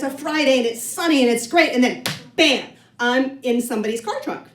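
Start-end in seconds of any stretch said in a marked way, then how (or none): none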